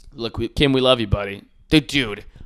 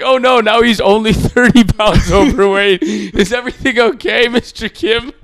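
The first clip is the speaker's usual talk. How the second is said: high, louder mock panic; worried citizens; 8 semitones higher, 7 dB louder than usual. North Koreans fret over Kim's weight loss, mock worried. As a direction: fret like an anxious subject. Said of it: "they're like".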